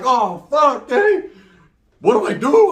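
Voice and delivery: high, loud panicked plea; hammed-up high panic; mock panic. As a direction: freak out asking for help. Panic in front of daughter.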